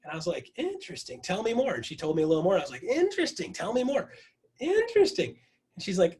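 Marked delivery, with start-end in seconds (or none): 0.6-1.7 high, drawn-in "interesting"; playfully exaggerated curiosity; intrigued reply. Acting own intrigued reply. 2.8-5.4 very high, playful curiosity; hammed-up high-pitched interest; heightened intrigue. Repeats intrigued reply, heightened.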